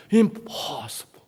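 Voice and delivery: slow unvoiced whisper of impossible. Reading God's writing aloud in a whisper. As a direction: breathe it out, awed. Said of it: "나한테 읽으래".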